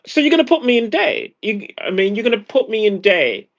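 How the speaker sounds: high, fast, indignant question; incredulous retort. Retold or imitated. Retelling his reply to the VA loan offer, high and indignant. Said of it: "I'm like".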